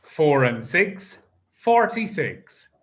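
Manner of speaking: steady sing-song number calling; bingo caller. Acted. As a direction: call each number out to the hall. Calls the bingo numbers live.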